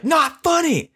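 high, indignant imitation of a dizzy friend; high-pitched mock protest. Storyteller voices his friend hanging off the ride, high mock protest. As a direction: squeal it like an upset kid, imitating. Retold friend after the spinning.

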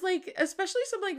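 usual speaking voice

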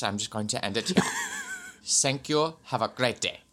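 rambling mock-German press statement; hammy German accent, hesitant official; put-on German accent. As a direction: improvise as a German spokesman with a thick comic accent. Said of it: "we're, we're in Germany, pal".

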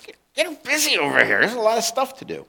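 a lightly voiced harried hostess; mock bossy Martha. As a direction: play Martha nagging her sister to get working. Martha's complaint voiced mockingly.

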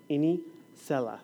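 "Inisela" said slowly, EE-nee-SEH-lah; "Inisela" slow and deliberate; careful pronunciation. Shown as a demonstration. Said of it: "takes me a long time to say her name".

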